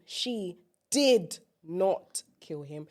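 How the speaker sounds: did drawn out, very slow; stress on did. Shown as a demonstration. Showing a friend how to stress did, very slow. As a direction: lean on did and stretch it. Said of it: "put emphasis on certain words".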